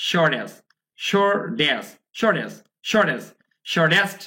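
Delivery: "shortest" as "shor-des", T softened or dropped; American dropped T. Shown as a demonstration. Teacher drilling American speech, "shortest" as "shor-des". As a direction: drop the hard T in "shortest". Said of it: "ঠিটাকে তারা drop করে".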